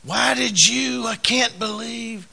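high, slow, whiny complaint to God; drawn-out mock grievance, pitched high; fussing at God. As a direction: whine it up at heaven like a let-down believer. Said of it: "fuss at God".

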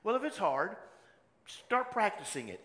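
ordinary delivery

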